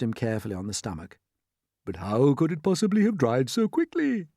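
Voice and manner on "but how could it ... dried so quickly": higher, rising, baffled question; puzzled old grasshopper. A narrator's reading. Old grasshopper asking about the dried paint, puzzled. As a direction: ask it in a puzzled character voice.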